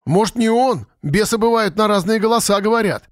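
high-pitched, lightly alarmed warning; warning objection. A narrator's reading. Village boy cautioning the others, warning objection. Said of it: "предостерегающе возразил голос".

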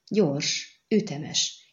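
plain reading voice

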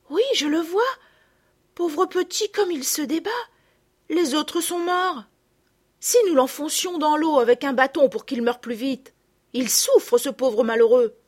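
lightly voiced distressed child; pitying girl. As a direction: feel for the suffering creature. Pitying the drowning hedgehog.

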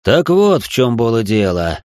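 low, slow nobleman voice; deep measured aristocrat; marquis voice. Acted. Marquis character voice.

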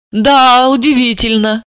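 feigned embarrassment. Pretend. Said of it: "изобразила смущение Далила".

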